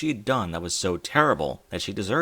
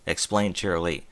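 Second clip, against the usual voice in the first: plain reading voice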